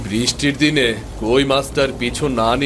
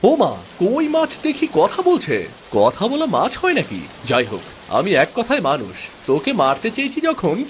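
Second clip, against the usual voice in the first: put-on high, restless young prince grumbling at the rain; a spoiled boy fishing in the rain and losing patience; boy's voice; 8 semitones higher than usual. Prince mocks the talking fish. (বৃষ্টিতে বিরক্ত ছোট রাজপুত্রের উঁচু গলা)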